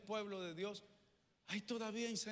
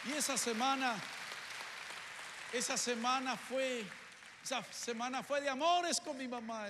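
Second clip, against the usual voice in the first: louder, higher repeated build toward the climax; building preacher's cry; 4 semitones higher, 5 dB louder than usual. Real, not acted. Preacher tells congregation of that week with his mother, rising fervently. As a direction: swell it up with each repeat.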